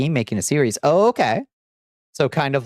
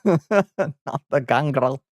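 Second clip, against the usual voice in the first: laughing; 3 semitones higher, much slower than usual. Pretend. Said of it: "repeated through laughter".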